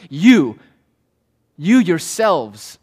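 "You" punched loud, then a pause; slow, heavy stress on "you yourselves"; emphatic pronoun. Shown as a demonstration. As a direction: set "You" apart and hit it hard. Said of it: "They're emphatic. They're fronted".

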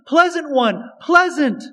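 mild mock calling of "pleasant one"; people calling her. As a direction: call out to her like the townspeople, lilting. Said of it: "to hear the people call her".

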